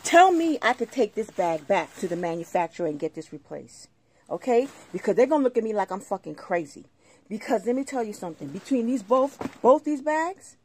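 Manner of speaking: loud, indignant real outburst; angry challenge. Real, not acted. Resident daring anyone to say the bags can be replaced, indignant. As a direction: challenge them loudly, in disbelief.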